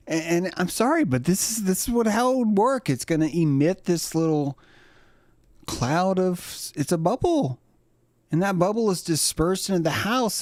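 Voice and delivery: high-pitched dog explainer; put-on high pet voice; talking dog.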